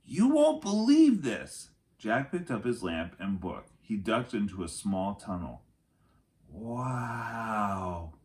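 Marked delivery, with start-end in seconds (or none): 0.0-1.7 slow, loud, high and thrilled; excited call. Acted. Annie excited in tunnel. 6.5-8.1 distant wow. Acted. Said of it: "came Annie's voice".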